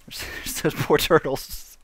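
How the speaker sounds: slow words lost in laughter; real laughter swallowing the words; guilty laughing. Real, not acted. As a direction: giggle helplessly through the line. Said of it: "I shouldn't laugh at that".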